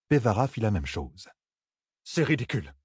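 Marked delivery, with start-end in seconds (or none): 2.0-2.7 higher-pitched, understated grumble; grumbling. A narrator's reading. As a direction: grumble it dismissively. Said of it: "maugréa Thalène". (maugréé, avec une pointe d'agacement)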